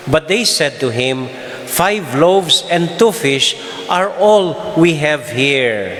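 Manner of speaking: normal talking voice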